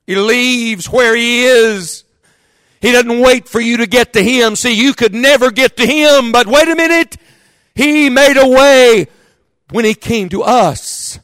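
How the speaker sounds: fervent revival preacher; preaching at full force. Acted. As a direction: lift the congregation as the climax builds.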